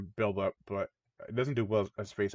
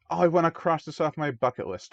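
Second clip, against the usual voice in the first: higher, louder mock enthusiasm; eager young couple; 6 semitones higher, 5 dB louder than usual. Young couple wanting to cross fishing off, mock-eager. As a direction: imitate an excited bucket-list wisher.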